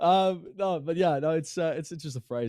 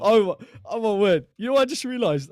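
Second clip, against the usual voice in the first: higher and louder, a startled realization; sudden surprise; 8 semitones higher, 7 dB louder than usual. Real, not acted. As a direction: jolt up as it dawns on you. Suddenly realizes they're still live.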